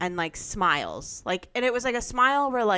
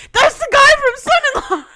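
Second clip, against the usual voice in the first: high and much louder blurted announcement; mock tipsy shout; 11 dB louder, much faster than usual. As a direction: yell it across the restaurant. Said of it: "And she goes".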